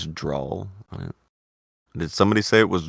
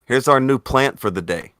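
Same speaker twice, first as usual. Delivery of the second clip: upbeat plant-show host, higher and louder; cheesy show host; 6 semitones higher, 6 dB louder than usual. Retold or imitated. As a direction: announce it like a chirpy TV presenter.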